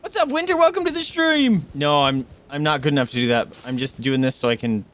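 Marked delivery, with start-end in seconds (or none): 0.0-1.6 very high, sustained excited welcome; excited greeting. Real, not acted. Streamer greeting a viewer who joins the stream, excited.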